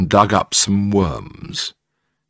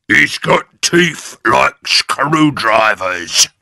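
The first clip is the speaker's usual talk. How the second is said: put-on slow, louder sly old man; Mister Twit; 6 semitones higher, 6 dB louder, slower than usual. A narrator's reading. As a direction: relish every scary word. Husband scaring his wife.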